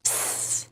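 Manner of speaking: "S" as a bare hissing consonant, no vowel; hissed S sound. Shown as a demonstration. Teacher showing the S at word ends, a soft hiss. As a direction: make only the sound of "S", a soft drawn-out sss. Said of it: "then it has the sound".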